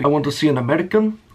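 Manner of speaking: put-on a half-faded Indian accent, quick and buttering up; a light Indian accent, boss flattering his employee; Hardy's Indian accent. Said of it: "I can't even do the accent anymore".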